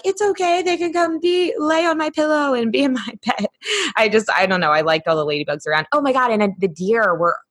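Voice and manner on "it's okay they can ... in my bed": much higher, sing-song soothing; sweet reassurance. Retold or imitated. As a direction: coo it like nothing's wrong. Own reply to partner, sweet.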